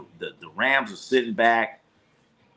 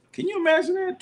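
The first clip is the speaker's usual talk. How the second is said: squeaky disbelief; faster than usual. Real, not acted. Vlogger reacting to a blowout score, squeaky disbelief. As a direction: jump way up in shocked falsetto.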